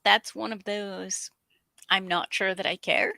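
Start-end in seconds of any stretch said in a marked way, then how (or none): 0.0-1.3 a breathy whispered aside. Real, not acted. Co-host whispering an aside about the show.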